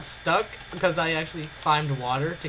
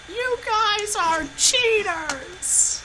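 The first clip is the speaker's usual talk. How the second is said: high, slow whiny accusation; drawn-out mock outrage; mock accusing voice; much slower than usual. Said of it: "people would go".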